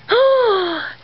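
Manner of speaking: sharp delighted gasp; excited gasp. Real, not acted. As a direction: gasp with joy. Opening Easter egg, thrilled.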